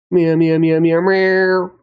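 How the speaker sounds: high playful meows like a cat; cat meowing. Pretend. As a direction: meow at the camera like a kitten. Sudden cat noises mid-talk.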